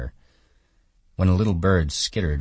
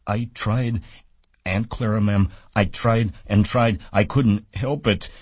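put-on higher, childlike pleading; pleading Lennie; 7 semitones higher, 3 dB louder than usual. A narrator's reading. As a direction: beg forgiveness like a child. Lennie answers aunt.

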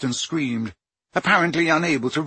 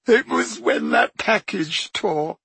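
high, slow tearful voice; sobbing; 5 semitones higher, slower than usual. Acted. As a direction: sob the words out. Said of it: "sobbed Leanne".

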